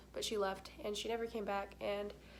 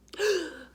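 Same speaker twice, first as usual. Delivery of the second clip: gasp; 7 semitones higher, 9 dB louder than usual. Retold or imitated. Shows struggling for breath.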